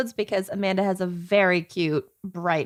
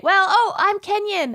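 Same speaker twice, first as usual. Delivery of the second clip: high silly voice; 10 semitones higher than usual. Pretend. Host intros in silly voices.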